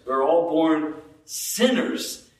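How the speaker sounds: "sinners" set off by a pause and lifted; stress on sinners. Shown as a demonstration. Preacher showing each word matters, stress on "sinners". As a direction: hold back, then land hard on "sinners". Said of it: "Any word we could emphasize there".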